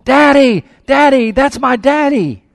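put-on high, loud little girl's scream; child screaming daddy. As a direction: scream it like a three-year-old spotting her father. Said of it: "began screaming at the top of her lungs".

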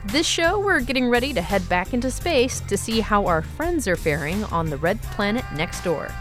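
usual speaking voice

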